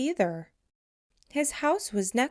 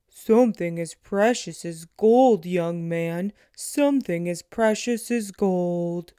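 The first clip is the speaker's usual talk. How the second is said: loud, booming giant of a man; 4 semitones lower, 4 dB louder than usual. Acted. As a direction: boom it out like a giant. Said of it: "who had a voice like a giant".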